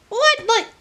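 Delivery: What jumps way up in disbelief; incredulous what. Real, not acted. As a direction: squeak What in shock at it all. Disbelief at too many events.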